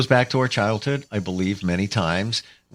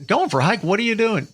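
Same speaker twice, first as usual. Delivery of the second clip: incredulous friend; 10 semitones higher than usual. Retold or imitated. Friend mocks the hike excuse.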